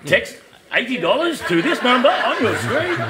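slow, high, hammed-up infomercial pitch; mock TV ad voice. Joking about the new mugs, cheesy infomercial voice. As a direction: sell it like a cheesy late night ad.

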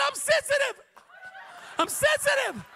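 high shouted whine, laughing through it; hammed-up shrill complaint; mock distressed lady. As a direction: imitate the fragile lady wailing. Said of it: "She said".

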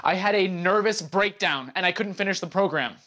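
high, distressed defensive burst; upset outburst. Acted. Defending against being called a quitter, upset outburst. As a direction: blurt the confession out, pitch way up. Upset confession during acted quarrel.

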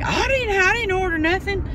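high mock old-lady voice; imitating Mama. Mama refusing the wig delivery, high mimicking voice. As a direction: squeak it out like Mama protesting. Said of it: "so she don't say".

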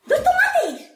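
very high, loud laughing exclamation; gleeful squeal. Acted. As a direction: squeal the silly guess with delight. Excited guess at next page.

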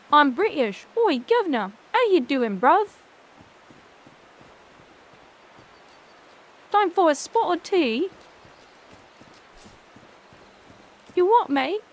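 hammed-up British banter; mock British accent, playful and lilting. Streamer plays British for chat, hammed-up British accent. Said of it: "It is an authentic British accent".

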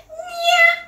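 high-pitched, loud vendor shout; street oil seller's call. Retold or imitated. Showing partner how oil sellers sound in Jakarta, high hawker's call. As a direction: hawk minyak down the street in a high voice. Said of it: "like that".

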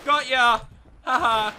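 high, loud triumphant crow; gloating. Real, not acted. Gamer gloating over a flanked enemy, high loud triumph.

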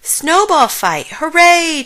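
loud, high cheer. A narrator's reading. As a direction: cheer it out in delight. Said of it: "cheered Frog".

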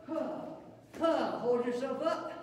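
ordinary talk

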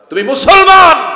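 high, loud, slow declaration; thundering shout. Real, not acted. Preacher shouts at the young men. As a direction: shout it like a verdict.

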